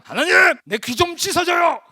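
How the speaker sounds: high, loud cry for help; acted plea to God. Believer in the prayer room begging God to cleanse their ear, loud plea. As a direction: call out to God at full voice.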